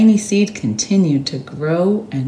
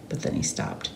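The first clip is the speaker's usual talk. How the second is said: hushed whisper, suspense; whispered pause; 12 dB quieter than usual. A narrator's reading. Narrator halting the story in a whisper.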